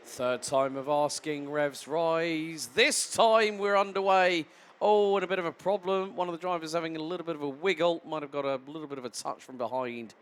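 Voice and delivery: live play-by-play. Real, not acted. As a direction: call the start as it unfolds. Live play-by-play of race start.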